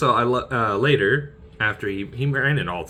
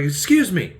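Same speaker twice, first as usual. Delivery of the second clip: pompous Porthos; 9 semitones higher than usual. Retold or imitated. Porthos berating D'Artagnan over the sash, pompous. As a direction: puff up and complain grandly. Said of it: "He's like".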